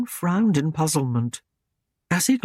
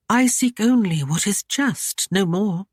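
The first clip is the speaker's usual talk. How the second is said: higher, feigned innocent woman's voice; coy young lady; 6 semitones higher than usual. Acted. Ashlyn feigning innocence to the knight, higher and coy. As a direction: play innocent, sweetly teasing.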